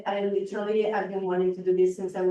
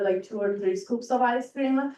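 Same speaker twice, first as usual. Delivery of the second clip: usual speaking voice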